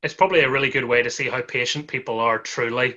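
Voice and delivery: usual speaking voice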